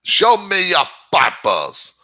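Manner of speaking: half-hearted stab at a German checkpoint officer; mock Nazi officer. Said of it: "not German accent. I can't do it".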